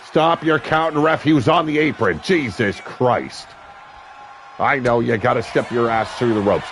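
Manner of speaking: ordinary talk, nothing marked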